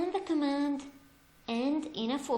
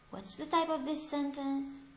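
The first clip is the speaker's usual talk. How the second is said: ordinary delivery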